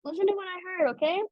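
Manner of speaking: mother pulling workers from work to gossip, high and eager; gossipy mom. Retold or imitated. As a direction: pull them in with juicy gossip. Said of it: "be like".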